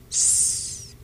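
soft unvoiced hiss; snake hiss. A narrator's reading. The snake in the night song, soft hiss. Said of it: "The snake".

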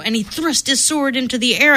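ordinary delivery

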